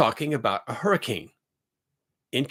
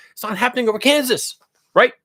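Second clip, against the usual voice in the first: high, loud incredulous protest; exasperated emphasis; 10 semitones higher, 7 dB louder than usual. Real, not acted. Scoffing at viewers who joke about a Kansas hurricane, loud and high. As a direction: hammer home that it can't be Kansas.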